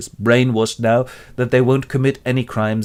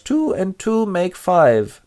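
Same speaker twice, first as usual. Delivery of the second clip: very slow, measured; slow dictation; 8 semitones higher, much slower than usual. Acted. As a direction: spell it out slowly. Winston writes.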